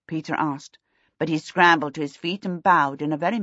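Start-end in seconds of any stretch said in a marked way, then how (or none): none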